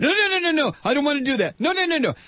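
high, whiny mock refusal; mocking scared conservative. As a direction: whine like a coward dodging change. Mimics fearful conservative refusing change.

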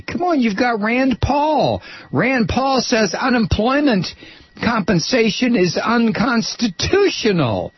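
mock them with scorn. Real, not acted. Host mocking Kentucky voters.

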